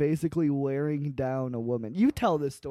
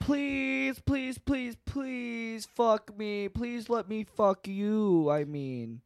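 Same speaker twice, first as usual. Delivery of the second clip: high, slow begging whine; nasal whine; 5 semitones higher than usual. Retold or imitated. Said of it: "he whined".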